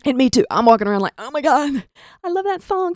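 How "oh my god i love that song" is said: high-pitched fan squeal; mock fangirl, voice shooting up; starstruck gushing. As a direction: gush like an overexcited fan.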